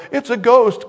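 mock alarm. Disciples crying out in fear.

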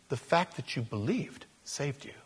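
softly whispered conviction. Real, not acted. Preacher to congregation, whispered emphasis. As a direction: lean in and whisper the truth.

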